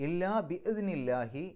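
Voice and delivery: slow measured chant of ഇല്ലാ ബിഇദ്നില്ലാഹി; Quranic recitation. Shown as a demonstration. Teacher recites the verse for the class, chanted. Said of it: "പാരായണം നിർത്താം".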